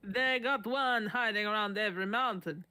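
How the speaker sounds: a lightly put-on Mexican accent, barely off his own voice; half-hearted Mexican accent on the patient's words; bad Mexican accent. Narrator voicing the frightened patient, in a mild Mexican accent. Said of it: "Should I do a bad Mexican accent?".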